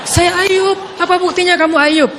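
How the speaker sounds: loud, high insisting back and forth; insistent exclamations. Retold or imitated. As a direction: protest the stranger isn't her husband. Identity dispute continues. (istri menyangkal dengan suara tinggi dan keras)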